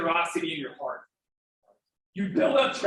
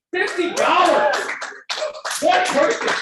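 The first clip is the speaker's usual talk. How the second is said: much higher and louder, a rallying cry; excited shout; 9 semitones higher, 5 dB louder than usual. Real, not acted. Fundraiser host whipping up applause from donors, shouting excitedly. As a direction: punch each phrase to the room like a cheer. Hyping the gala crowd for applause.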